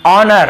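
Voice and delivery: "ऑनर" starting straight on the vowel; silent h. Shown as a demonstration. Teacher models the word for students, h silent. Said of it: "एच नहीं पढ़ना है".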